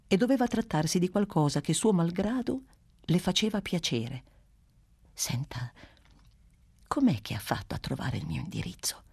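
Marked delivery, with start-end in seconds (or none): 5.1-9.0 low whisper, curious and secretive; hushed question. Acted. Teacher whispering to Bruno how he found her address. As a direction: ask it as if someone may overhear.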